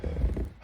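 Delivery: long, soft breathy sigh. Real, not acted. Speaker struggling to explain something hard, long sigh. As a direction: exhale before trying to explain.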